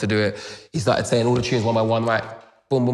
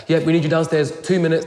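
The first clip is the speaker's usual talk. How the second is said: louder, higher call to hurry; calling upstairs; 7 semitones higher, 5 dB louder than usual. Retold or imitated. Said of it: "someone's come upstairs like".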